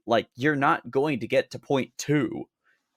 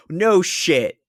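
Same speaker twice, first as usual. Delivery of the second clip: loud, higher sarcastic jab; 8 semitones higher, 7 dB louder than usual. Real, not acted. Co-host scoffing at the advice to avoid sarcasm, sarcastic. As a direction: say it dripping with sarcasm. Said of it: "He says sarcastically".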